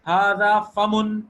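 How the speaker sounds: ھذا فم stretched out very slowly and loudly; slow model reading. Shown as a demonstration. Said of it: "یہ سارا ھذا کے ساتھ ہے".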